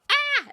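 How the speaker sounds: high shocked yelp, fleeing; mock shriek of fleeing shoppers; high loud startled yelp. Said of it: "people just going".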